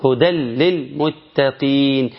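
slow "هدى للمتقين", tanween gone, doubled lam; Quranic idgham. Shown as a demonstration. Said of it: "شيل التنوين وحط لام". (ترتيل بطيء بإدغام التنوين في اللام)

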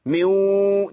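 ميو with a nasal waw; idgham demo. Shown as a demonstration. Teacher showing nasalised waw. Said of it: "دي ياء مغنى".

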